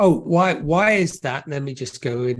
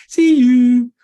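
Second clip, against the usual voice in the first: loud, high sing-out goodbye; mock cheery farewell; 8 semitones higher, 10 dB louder than usual. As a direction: sing it out as a breezy send-off. Mock breezy wife waving husband off.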